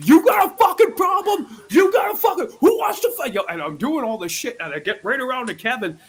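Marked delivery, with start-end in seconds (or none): 0.1-3.3 high, loud hammed-up challenge shout; fake bar-fight shout. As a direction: yell it like a staged brawler picking a fight. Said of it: "I'm like".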